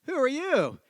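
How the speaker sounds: high, swooping stagey question; skit questioner. Acted. Guy on the bench asking Stanley, stagey question. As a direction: sing out the setup, pitch leaping. Guy delivers the setup line.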